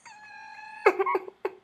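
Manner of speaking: very high-pitched fangirl squeal; shrieky, giggling squeak; giddy squeal. Real, not acted. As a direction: squeal with overwhelmed joy. Overwhelmed fangirl outburst.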